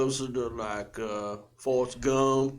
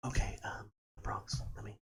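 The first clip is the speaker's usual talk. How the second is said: whispering; 4 semitones lower, 13 dB quieter than usual. Real, not acted. Prepares to do the accent.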